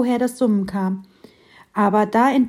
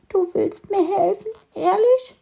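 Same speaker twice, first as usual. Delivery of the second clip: much higher teary squeak; 11 semitones higher than usual. Acted. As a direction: squeak it in disbelief. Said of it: "fiebte der Marienkäfer".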